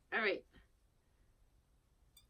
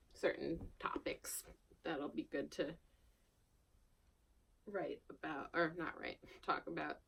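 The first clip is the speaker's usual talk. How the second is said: whisper; 3 semitones lower, 9 dB quieter than usual. Real, not acted. Aside while thinking about topics.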